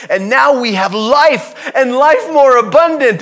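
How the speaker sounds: high, forceful sermon delivery; impassioned preaching. Real, not acted. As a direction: proclaim it to the congregation with rising force.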